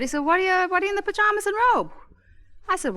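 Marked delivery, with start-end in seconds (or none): 0.3-1.9 husband voice. Retold or imitated. Husband baffled at her pajamas.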